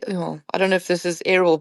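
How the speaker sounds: ordinary talk, nothing marked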